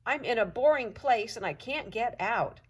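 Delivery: lightly voiced eager dog; dog's bark voice. A narrator's reading. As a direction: plead through the gate like a pup. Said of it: "I bark".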